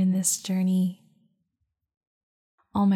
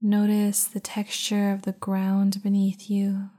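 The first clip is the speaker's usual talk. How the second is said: guided meditation. Acted. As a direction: guide them into calm, unhurried. Leads a meditation.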